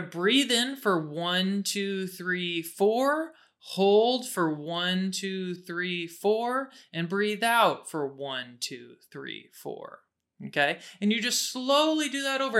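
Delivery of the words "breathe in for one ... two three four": slow, drawn-out counting, fading at the end; slow breath counting. Shown as a demonstration. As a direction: count the breaths slowly and calmly. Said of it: "demonstrates breath box".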